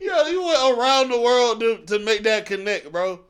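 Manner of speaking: loud, high, laughing through it. Real, not acted. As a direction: crack up while you say it. Laughing at the reach.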